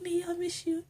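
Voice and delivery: high, soft, tearful voice; a touch of imitated tears; teary. Imitating teary Emma talking to Neil, softly tearful. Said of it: "She's like".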